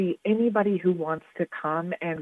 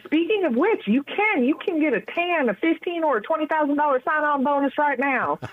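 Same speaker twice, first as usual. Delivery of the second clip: excited; 8 semitones higher than usual. Pretend. Pitches sign-on bonus auction-style.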